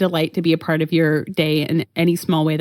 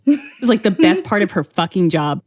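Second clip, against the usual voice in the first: normal talking voice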